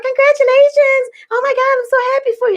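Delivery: high, fast, bubbly congratulations; gushing nurses. Retold or imitated. Nurses congratulating the pained mom, high bubbly gush. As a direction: mimic the overly cheerful nurses. Nurses cheering her in hallway.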